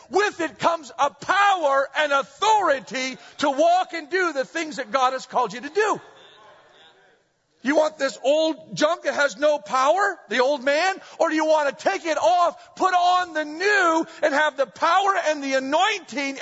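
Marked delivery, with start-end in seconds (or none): 0.1-6.0 preaching at full force. Acted. 11.2-14.1 loud preaching peak. Acted. Pastor urging church to put on new, loud.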